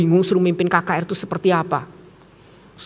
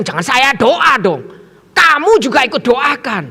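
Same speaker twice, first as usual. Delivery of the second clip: louder, higher pushback; insistent retort; 4 semitones higher, 4 dB louder than usual. Retold or imitated. Preacher telling teachers to pray too, insistent.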